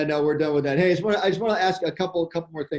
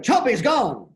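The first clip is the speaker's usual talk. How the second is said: higher, louder mock mayor line; imitating the mayor in alarm; 7 semitones higher, 4 dB louder, slower than usual. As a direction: lightly ham up the panicking mayor. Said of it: "the mayor was like".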